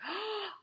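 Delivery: high delighted little gasp; proud gasp. Retold or imitated. Toddler proud of herself.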